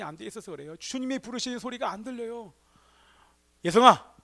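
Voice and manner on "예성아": calling the name out loud. Retold or imitated. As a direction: shout the name across the room. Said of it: "이렇게만 부르시겠습니까?". (크게 이름을 부르는 소리)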